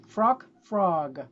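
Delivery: "Frog, frog": first clipped to frock, then o stretched; short vowel first, long vowel second; contrast frock then frog. Shown as a demonstration. Teacher models frock versus frog for students. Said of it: "See here one more time".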